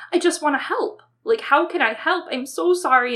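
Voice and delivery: high-pitched, pleading upset; mock in tears, desperate to help; imitated tearful mother. Mother to her upset child, imitated tearful plea. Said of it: "And she's in tears".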